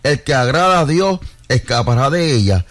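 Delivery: deliberate, phrase-by-phrase Bible reading; slow scripture reading. Real, not acted. Reads verses so listeners get the root, slow reading. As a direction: read slowly, pausing to let it land. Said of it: "los voy a leer despacio".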